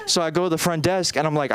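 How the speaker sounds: ordinary talk, nothing marked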